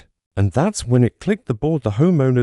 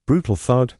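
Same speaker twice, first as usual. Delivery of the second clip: ordinary delivery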